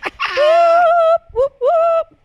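very high, loud whoops; excited whooping. Real, not acted. Host cheering loudly at the start of the show, very high.

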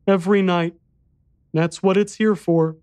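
put-on high little-boy voice; solemn, frightened boy. A narrator's reading. Boy warning the narrator of the beast, solemn child voice. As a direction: deliver the warning as a grave child.

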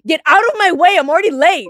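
high, loud mock-angry yell; hammed-up impatient shout; rude New Yorker. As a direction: bark it at someone blocking the sidewalk. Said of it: "Someone walks past me and goes".

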